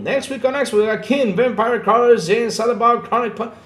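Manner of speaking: high, loud excited list; excited rundown. Real, not acted. Reels off new game releases excitedly.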